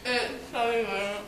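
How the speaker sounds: talking through marshmallows; mouth full. Real, not acted. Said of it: "and then you put them in your mouth".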